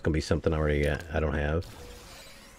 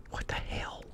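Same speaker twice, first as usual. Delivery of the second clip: hushed, stunned whisper; a baffled gamer whispering to himself; 14 dB quieter, slower than usual. Real, not acted. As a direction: breathe it out in disbelief. Quiet shock at full inventory.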